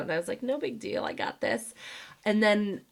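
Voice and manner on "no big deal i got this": high, airy and breathy; mock nonchalant parent; cool guy. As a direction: shrug it off lightly. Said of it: "totally went the cool guy route".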